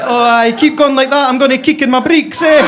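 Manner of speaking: loud, high, shouted Fife guy; Fife accent. Acted. Fife guy in bed with an American, broad shouted Fife accent. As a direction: yell it in broad Fife Scots. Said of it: "shagged by some guy for Fife".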